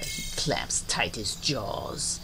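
low, soft, near-whisper; hushed narration. A narrator's reading. Narrator on gator holding his tongue, hushed. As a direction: drop to a tense hush.

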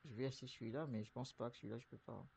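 ordinary talk, nothing marked

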